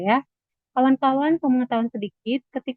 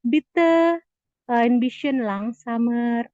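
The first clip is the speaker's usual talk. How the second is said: slow classroom demo; slow model phrase; much slower than usual. Shown as a demonstration. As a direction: stretch it out for the class. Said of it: "kita bisa ngomong lagi".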